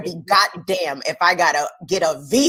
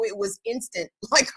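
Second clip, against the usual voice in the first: ordinary delivery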